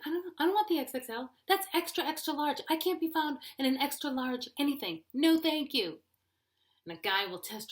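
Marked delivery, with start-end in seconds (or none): 0.0-6.0 higher, louder protest; indignant refusal. Acted. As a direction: refuse it, pitch raised, mock offended. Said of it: "I'm like".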